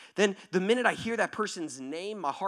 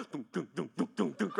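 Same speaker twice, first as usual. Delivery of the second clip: hollow repeated glug noises; imitated pouring; 3 semitones lower, 3 dB quieter than usual. Preacher miming pouring water out, rhythmic glug sounds. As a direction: mimic water glugging from a jug.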